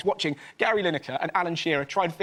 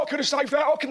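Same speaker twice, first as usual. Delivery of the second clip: boastful bloke; 7 semitones higher than usual. Acted. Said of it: "all these sort of blokes go".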